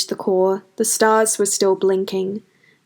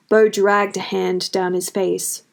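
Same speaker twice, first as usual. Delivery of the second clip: plain reading voice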